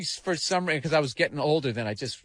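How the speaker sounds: ordinary talk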